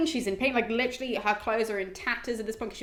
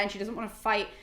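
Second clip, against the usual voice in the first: ordinary talk, nothing marked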